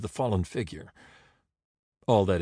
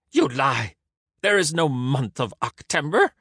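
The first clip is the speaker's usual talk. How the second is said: indignant denial; 4 semitones higher, 4 dB louder than usual. A narrator's reading. Caesar disputes the omen.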